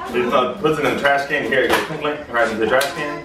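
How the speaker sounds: normal talking voice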